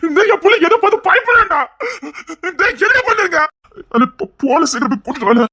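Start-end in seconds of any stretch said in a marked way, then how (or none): 0.1-3.4 high, loud, agitated outburst; raised voice, pitched way up; distraught shouting. Acted. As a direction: shout it in alarm over the child. Distraught over frightened crying child.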